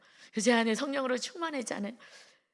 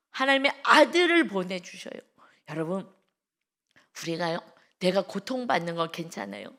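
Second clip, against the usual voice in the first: usual speaking voice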